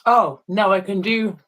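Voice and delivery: ordinary talk, nothing marked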